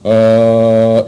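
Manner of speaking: monotone voice. Shown as a demonstration. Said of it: "just very monotone".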